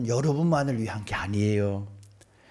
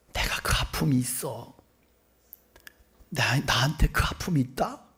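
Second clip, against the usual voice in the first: hushed confiding. Acted. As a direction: confide it gently, almost under the breath. Confiding own pain to sufferer.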